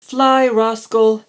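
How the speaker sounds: loud high slow command; shout. A narrator's reading. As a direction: urge the dragon on at full voice. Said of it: "Ella shouted".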